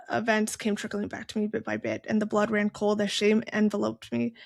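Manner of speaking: usual speaking voice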